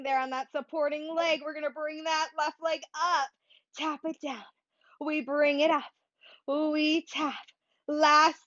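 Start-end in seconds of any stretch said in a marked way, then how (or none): none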